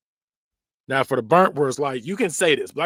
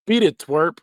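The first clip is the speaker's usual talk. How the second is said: higher, louder mock cartoon bully; 5 semitones higher, 5 dB louder, slower than usual.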